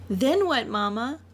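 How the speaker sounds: put-on high, eager little girl voice. Little Mina asking her mama what happens next, in a high child voice.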